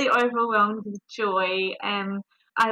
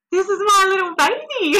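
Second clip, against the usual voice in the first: awed delight; 10 semitones higher, 9 dB louder than usual. Real, not acted. First sight of baby.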